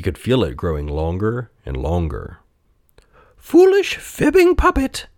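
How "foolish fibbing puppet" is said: very high, scolding insect voice; buzzing cricket. A narrator's reading. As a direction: scold in a tiny high buzz. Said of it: "buzzed a familiar voice".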